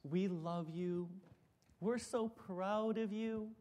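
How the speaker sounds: soft, slow, tender; gentle affirming mom. Retold or imitated. Mom gives her son a gentle hug before a match, softly. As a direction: murmur it like a loving mother. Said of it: "she'd always say something affirming".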